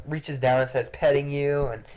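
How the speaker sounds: ordinary delivery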